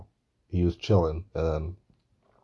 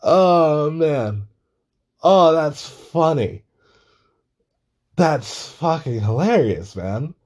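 laughing through the words, high and louder; 8 semitones higher, 4 dB louder, slower than usual. Real, not acted. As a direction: still laughing as you speak. Laughing at the story.

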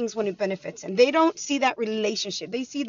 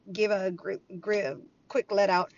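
ordinary talk, nothing marked

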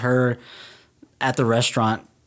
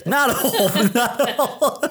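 much higher mock New York accent breaking into laughter; New York guy laughing; 10 semitones higher, slower than usual. Finishing the pizza bit, laughing New York accent. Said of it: "That's New York".

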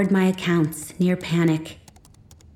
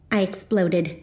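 plain reading voice

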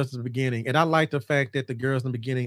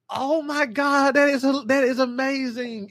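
delighted awe; 10 semitones higher, 3 dB louder than usual. Retold or imitated. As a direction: marvel at the twist. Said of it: "I was like".

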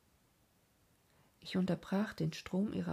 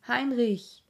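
loud high call across the path; old mother calling her son from afar; 5 semitones higher, 9 dB louder than usual. A narrator's reading. Old woman calling her lost son home, loud call. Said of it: "rief eine alte Frau".

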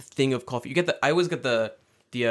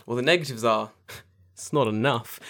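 ordinary delivery

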